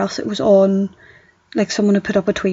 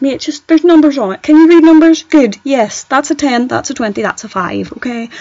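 exasperated telling-off; 4 semitones higher, 6 dB louder than usual. Retold or imitated. Telling off a confused customer.

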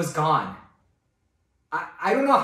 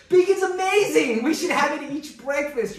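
high and loud, gushing over bacon; enthusing about bacon; 9 semitones higher, 5 dB louder than usual. Retold or imitated. Pastor telling his Korean wife bacon is amazing, gushing. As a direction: sell bacon to a skeptical wife. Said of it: "I tell my wife".

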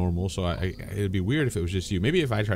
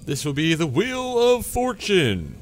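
higher, slow showman announcement; game show host; 11 semitones higher, 4 dB louder, slower than usual. Pretend. Announcing the wheel.

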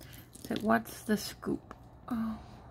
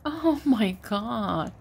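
excited gushing; 6 semitones higher, 4 dB louder, slower than usual. Real, not acted. Gushing over a cute trinket.

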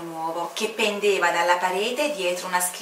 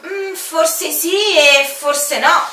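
slow, high, drawn-out doubt; slow sly hesitation; teasing commander; 9 semitones higher, 8 dB louder, much slower than usual. Acted. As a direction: draw it out, keeping him guessing. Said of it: "rispose per tutti il comandante".

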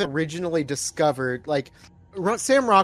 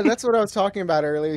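ordinary talk

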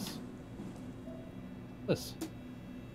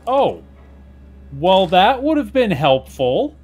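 loud, high, eager exclaiming; real excitement over the new chip; excited; 9 semitones higher, 10 dB louder than usual. Real, not acted. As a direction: burst out with delight at the find.

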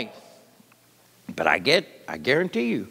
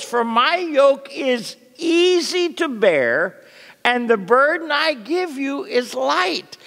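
gentle invitation; 7 semitones higher, slower than usual. Acted. Preacher voicing Jesus' gentle invitation.

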